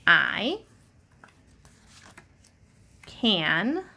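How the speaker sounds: each word set apart by long pauses; word by word reading. Shown as a demonstration. Teacher models reading the sentence word by word. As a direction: read it one word at a time. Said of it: "Read it with me".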